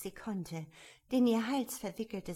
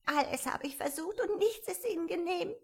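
sobbing; 10 semitones higher than usual. A narrator's reading. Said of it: "fuhr schluchzend fort".

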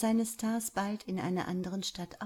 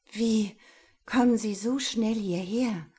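higher, louder astonished question; 4 semitones higher, 8 dB louder than usual. Acted. Laura running into Jerome in the parking lot, astonished. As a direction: caught off guard, blurt it out. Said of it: "fragte sie erstaunt".

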